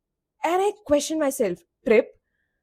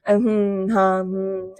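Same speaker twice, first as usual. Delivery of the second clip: bored filler replies; 4 semitones lower than usual. Retold or imitated. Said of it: "ऐसे फालतू की reply कर रही थी मैं".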